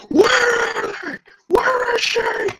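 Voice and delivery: high, slow, breathy strained rasp; Batman interrogation impression. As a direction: imitate Bale growling at Joker. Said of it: "interrogating Joker in The Dark Knight".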